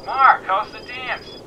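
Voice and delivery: high, slow, thin phone voice; voice over a device. A narrator's reading. Annie takes McKenna's call, thin phone voice. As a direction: answer as Annie on a filtered line.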